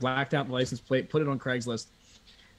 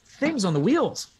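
eager customer; 9 semitones higher, 4 dB louder, slower than usual. Retold or imitated. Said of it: "And he says".

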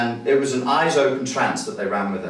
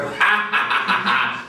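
mock jeering laughter; slower than usual. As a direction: imitate a gang of friends laughing at him. Girl's friends mocking in imagined video.

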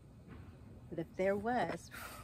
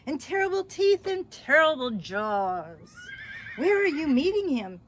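scary description; 7 semitones higher, 7 dB louder than usual. Acted. Scary description, fox alarmed.

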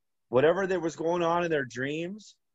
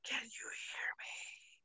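slow ghostly whisper, higher; eerie whisper; 7 semitones higher, 19 dB quieter, slower than usual. Retold or imitated. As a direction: breathe it right into the ear. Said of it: "it was, like, whispering right in my ear".

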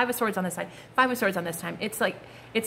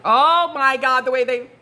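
excited; 4 semitones higher, 8 dB louder, slower than usual. Real, not acted. Excited outburst at the cards.

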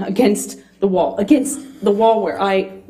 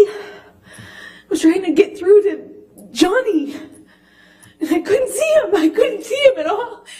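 crying; 9 semitones higher than usual. Real, not acted. Reliving the assault, still crying.

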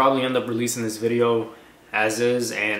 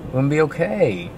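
reassuring; 4 semitones higher than usual. Real, not acted. Husband comforting anxious wife.

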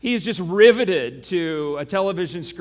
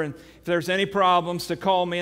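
ordinary talk, nothing marked

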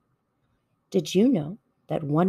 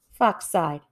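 plain reading voice

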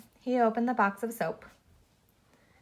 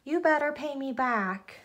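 mild narrated yell; 6 semitones higher than usual. A narrator's reading. Arthur to the dog's owner about eaten soap, mild yell. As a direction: wail it slowly in protest. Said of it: "yelled Arthur".

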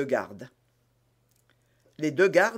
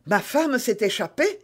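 mild astonished question; exclamation surprise; 7 semitones higher, 3 dB louder than usual. A narrator's reading. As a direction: ask in startled disbelief, rising at the end. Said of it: "s'écria Bonacieux". (légère stupeur, ton montant)

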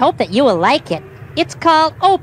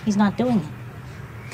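usual speaking voice

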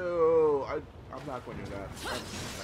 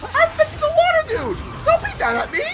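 much higher, louder frantic begging; frantic pleading; 6 dB louder than usual. Real, not acted. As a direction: beg the monster not to be mad. Pleading with attacking monster.

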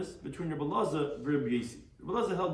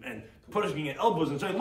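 ordinary delivery

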